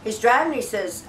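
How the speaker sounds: normal talking voice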